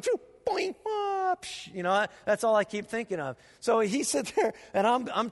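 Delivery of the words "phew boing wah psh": high silly cartoon sound effects; cartoon noises. Pastor imitates his chase thoughts as high cartoon noises. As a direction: spit out goofy chase noises. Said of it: "I'm thinking".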